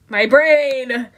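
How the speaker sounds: loud exasperated cry; frustrated outburst. Real, not acted. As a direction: blurt it out in frustration. Frustrated at own brain.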